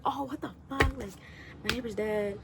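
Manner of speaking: high-pitched mock outrage; worked-up imitation, rising in volume; going on and on. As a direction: act out a man freaking out, building to a loud accusation. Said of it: "he's going on".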